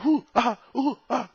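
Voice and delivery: high, loud mocking ooh ah noises; mocking Lex Luthor. Mocking Jesse Eisenberg's Lex Luthor for viewers, high squeaky parody. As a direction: parody the actor's weird tics in a squeaky voice. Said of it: "doing that weird shit".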